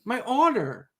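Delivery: high-pitched complaint without added volume; indignant yelling. Real, not acted. Host complaining to co-host about not being defended, high indignant yelling. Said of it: "I'm yelling at you".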